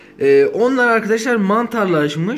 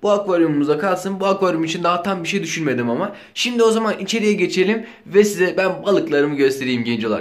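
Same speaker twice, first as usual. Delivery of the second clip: usual speaking voice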